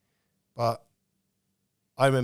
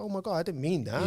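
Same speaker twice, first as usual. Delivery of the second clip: surprised wife; 8 semitones higher than usual. Retold or imitated. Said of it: "she'd go".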